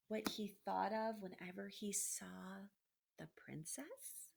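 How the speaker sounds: usual speaking voice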